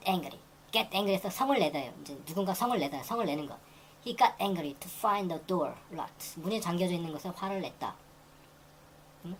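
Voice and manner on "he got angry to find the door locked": very slow, careful textbook read; slow example reading. Shown as a demonstration. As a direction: lay out every word slowly for learners. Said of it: "teacher reads example sentence". (천천히 읽는 예문)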